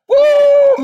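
high, loud whoop of delight; excited preacher's whoop. Real, not acted. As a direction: let out a joyful whoop.

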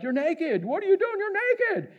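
high, shocked yelling; shocked onlookers. Retold or imitated. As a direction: gasp it out like scandalized witnesses. Shocked onlookers shout at naked man.

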